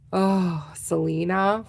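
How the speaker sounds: usual speaking voice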